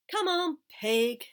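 loud, coaxing call; calling a pig. A narrator's reading. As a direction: call a pig over to its food.

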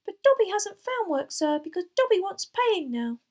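very high, loud elf voice; insistent little house elf; squeaking. A narrator's reading. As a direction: squeak it proudly and emphatically. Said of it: "Dobby squeaked".

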